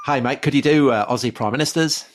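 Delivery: hint of an Aussie fan asking a favour; Australian accent. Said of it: "they didn't say it in that accent".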